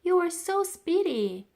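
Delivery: high, loud delighted exclaim; girl's high amazed cry. A narrator's reading. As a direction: marvel at how fast he is. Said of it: "Florentine exclaimed".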